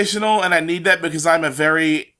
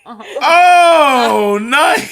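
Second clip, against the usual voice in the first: excited; 7 semitones higher, 7 dB louder than usual. Real, not acted. Excited reaction to comic book.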